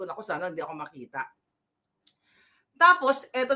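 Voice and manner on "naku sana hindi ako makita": quick, soft hope not to be seen; hushed hurried aside; hushed hiding. Retold or imitated. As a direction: murmur it fast and low like someone hiding. Hiding, hoping not to be found.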